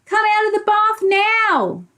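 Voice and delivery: high, slow call from outside; little voice calling. Acted. Mum calls Joe from outside the bathroom, high and slow. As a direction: call him out of the bath from another room. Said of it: "a little voice coming from the".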